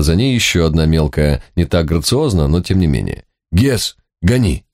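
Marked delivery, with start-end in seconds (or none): 3.5-4.6 slow, urgent call to go; urgent shout. Acted. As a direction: urge the horse on. Urging the dog on.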